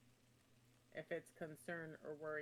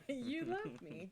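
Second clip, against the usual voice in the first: delighted; 9 semitones higher, 6 dB louder, slower than usual. Pretend. Player gushing at sentient axe.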